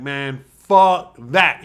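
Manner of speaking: slow, loud, drawn-out refusal; voicing a stubborn dog; defiant dog. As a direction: stretch it out, dismissive.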